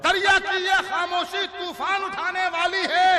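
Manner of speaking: high, loud declamation; forceful verse recital. Acted. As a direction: project the couplet over the crowd. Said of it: "किसी शेर पर जोर लगाऊंगा". (ज़ोर लगाकर शेर पढ़ना)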